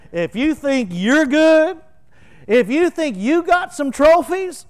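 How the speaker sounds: high, loud taunting brag. Pretend. Preacher mocking a braggart, taunting and loud. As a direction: lord it over them like a show-off.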